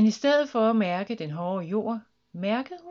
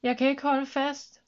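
higher, urgent call; mildly panicked raised voice; alarmed shout; 6 semitones higher, 4 dB louder than usual. A narrator's reading. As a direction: call out while losing your grip. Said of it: "råbte en anden".

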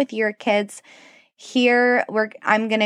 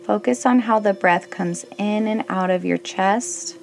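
guided meditation. Acted. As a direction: gently guide them into stillness. Leads a kids' meditation.

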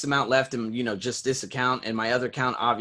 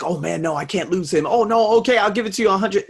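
higher, louder, rushed begging; panicked pleading; 7 semitones higher, 7 dB louder than usual. Retold or imitated. His old self panics about losing a client, louder and rushed. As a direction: beg not to lose him, rushing. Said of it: "before, I'd be like".

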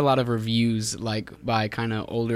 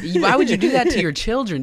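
higher and louder, faking parental outrage; mock outrage; 10 semitones higher, 6 dB louder than usual. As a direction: play the scandalized parent, over the top. Mocking outraged parents' complaint.

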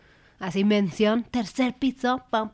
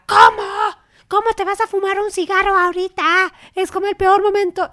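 louder, higher mock outrage; mock scolding mom; 9 semitones higher, 8 dB louder than usual. Daughter imitating mom scolding dad before a flight, loud. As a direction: play the exasperated mom.

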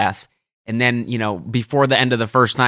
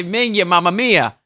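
higher, lilting Italian-accent exclamation; 5 semitones higher than usual. Shown as a demonstration. Caddie showing what people say, Italian-accent exclamation. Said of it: "saying it in an Italian accent".

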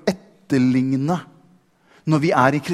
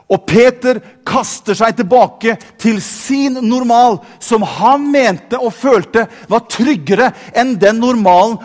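impassioned preaching; 7 semitones higher, 7 dB louder than usual. Real, not acted. Preacher tells how Peter walked toward Jesus, impassioned preaching. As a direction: preach it at full force, stretching the key words.

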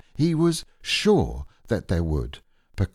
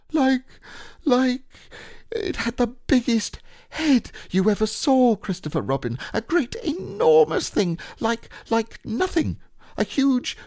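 high squeaky piglet voice, nervous; frightened Piglet; 13 semitones higher than usual. Acted. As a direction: stammer it out high and scared. Frightened Piglet describing monster.

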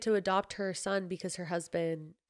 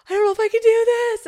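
high-pitched loud tearful wail; mock crying; 15 dB louder than usual. Retelling her own panic over the pregnancy, mock crying. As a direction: wail it out in a much higher voice. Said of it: "I was crying because I was like".

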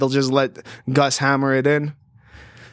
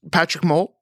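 ordinary delivery

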